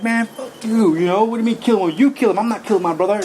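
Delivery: indignant retold holler, refusing the command; angry yelling. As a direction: shout back in defiant anger. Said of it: "I got really mad and started screaming and hollering".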